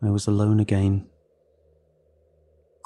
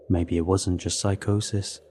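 plain reading voice

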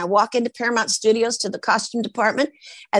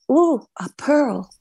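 a slow, wondering little "Ooh" as the oyster shows its pearl; a hint of a clam finding its treasure; clam impression; 4 semitones higher, slower than usual. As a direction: draw it out, awed, as if you just made a pearl. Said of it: "my impression of a clam that turns into an oyster".

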